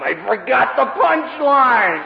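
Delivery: high, slow, sheepish put-on voice; hammed-up forgetful speaker; mock sheepish guest. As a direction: drag it out like a befuddled old speaker. Retold guest speaker quote.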